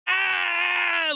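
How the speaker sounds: loud, high, drawn-out "aah"; imitated outraged scream. Imitating a woman reacting on camera, high mock scream. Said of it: "you literally see a woman going".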